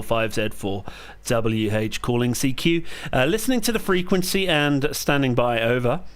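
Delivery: steady, clipped radio call sign readout; radio call procedure. Real, not acted. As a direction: call it out like a ham operator. Calling CQ on ham radio.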